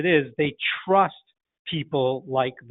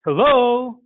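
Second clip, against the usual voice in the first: prospect answering high; 7 semitones higher, 5 dB louder than usual. Shown as a demonstration. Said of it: "So they say".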